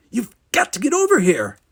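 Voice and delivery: loud, high, urgent order; shouting. A narrator's reading. Geordi orders late Will to the stage, shouting. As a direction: shout it as a desperate demand. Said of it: "shouted Geordi".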